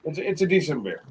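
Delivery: normal talking voice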